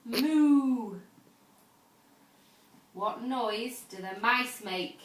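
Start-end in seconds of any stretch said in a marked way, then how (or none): 0.0-1.0 put-on a loud, drawn-out cow moo for a toddler; playful farmyard moo. Shown as a demonstration. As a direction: moo like a cow to show the baby. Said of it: "What noise does the cow make".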